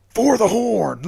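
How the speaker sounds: rally the Horde; battle cry. Pretend. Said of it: "go straight World of Warcraft".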